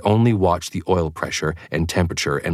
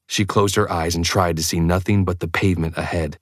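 usual speaking voice

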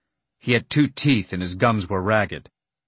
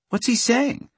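much higher, curious voice; eager high question; 13 semitones higher than usual. A narrator's reading. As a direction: ask brightly and eagerly.

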